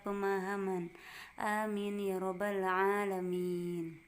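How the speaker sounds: slow, measured prayer chant; prayer recitation. Real, not acted. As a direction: recite the prayer slowly with the class. Class opening prayer.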